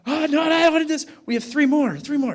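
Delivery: put-on kid bargaining. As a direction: play the kid begging to cut the reps. Son negotiating with swim instructor.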